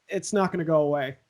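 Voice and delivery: ordinary delivery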